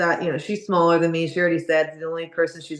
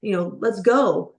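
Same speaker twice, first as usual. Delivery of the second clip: coach's scream, retold in a raised pitch; 7 semitones higher than usual. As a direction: imitate a coach urgently screaming to get out. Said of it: "literally screaming at her".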